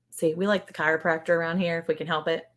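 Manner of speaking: normal talking voice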